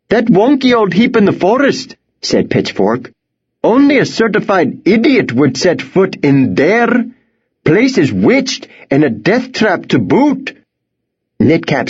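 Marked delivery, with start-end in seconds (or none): 0.1-2.0 high-pitched, scornful farmer voice. Acted. Pitchfork dismisses the old house, scornful farmer voice. 3.6-10.6 high-pitched, scornful farmer voice. Acted. Pitchfork dismisses the old house, scornful farmer voice.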